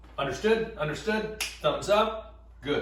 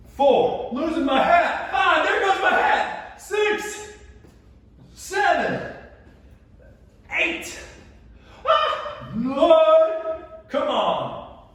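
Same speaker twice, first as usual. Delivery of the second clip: very high, loud voice under effort; high-pitched exertion, amused by a mishap; straining rep count; 12 semitones higher, 6 dB louder, much slower than usual. Real, not acted. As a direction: squeal the count through the strain. Straining through reps, hat falling off.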